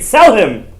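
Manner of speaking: high, loud shout of sell him; brothers' shout. Retold or imitated. Brothers deciding Yosef's fate, a high loud shout. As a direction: bark it like the angry brothers. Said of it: "they agreed".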